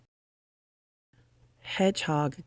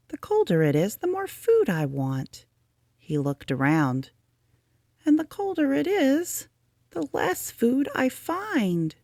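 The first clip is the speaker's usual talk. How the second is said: a hint of a higher, small Rabbit voice; lightly voiced Rabbit, puzzled; 11 semitones higher than usual. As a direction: Rabbit musing on the cold, gently.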